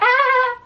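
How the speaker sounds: high loud witch shriek; sharp cackling cry; witch cackle. Retold or imitated. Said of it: "old stereotypical witch, like".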